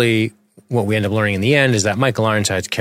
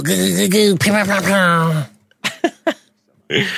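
silly pew pew noises; 10 semitones higher than usual. Pretend. Silly sound effects.

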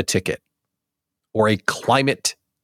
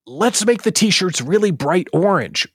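raised, keen brainstorming voice; eager pitch; 7 semitones higher than usual. Acted. Staffer building on the t-shirt idea, eager. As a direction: play a staffer proud of a small idea. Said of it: "let's go into the decision-making room".

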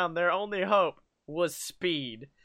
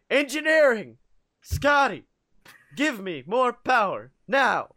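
urgent captain calling out orders, raised voice; James Kirk; 3 semitones higher, 3 dB louder than usual. Acted. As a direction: call down to the engine room, punchy and commanding. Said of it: "a nice little James Kirk impression".

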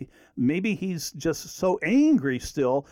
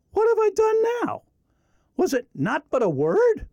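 high, pleading protest; a younger brother defending himself, higher; mild hurt protest; David protesting; 4 dB louder than usual. Acted. David answers angry brother, read aloud.

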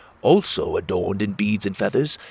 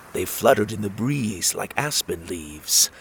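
plain reading voice

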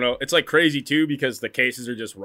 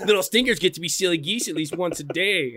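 higher, goofy singsong delivery; silly voice; 6 semitones higher than usual. Retold or imitated. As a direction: say it like a weird stranger. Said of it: "that's like".

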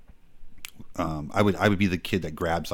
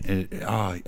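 ordinary delivery

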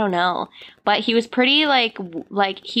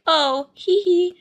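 mock calm giggle; 6 semitones higher than usual. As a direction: play it dainty and unbothered. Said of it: "be like".

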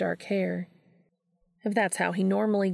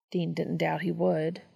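usual speaking voice

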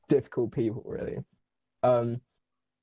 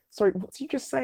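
high-pitched incredulous question, retold; retold disbelief; 9 semitones higher, faster than usual. Friend questioning a sudden plan to run, incredulous. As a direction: act out a startled double take.

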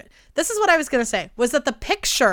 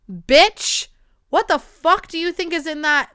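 loud, high-pitched mock outrage; exasperated outburst; 6 semitones higher, 6 dB louder, slower than usual. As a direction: tear into an absurd customer, exaggerated. Mock outburst at burrito customer.

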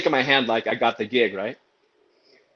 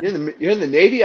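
quick, higher, louder double-take; surprised; 7 semitones higher, 6 dB louder, faster than usual. Retold or imitated. Boss double-checks the singer's Navy service, acted surprise. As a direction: stumble over it in surprise.

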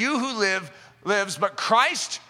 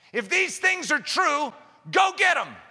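rousing shout; 8 semitones higher than usual. Real, not acted. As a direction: fire them up to go get it. Preacher urges the crowd to act.

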